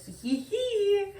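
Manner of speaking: high, slow giggling little voice; giggly curious soul. Acted. Tarot reader voicing the partner's soul, high giggly whisper-free squeal. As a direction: giggle it out like an eager soul peeking.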